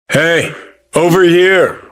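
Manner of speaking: high, projected hail; calling over. Acted. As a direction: shout to get someone's attention.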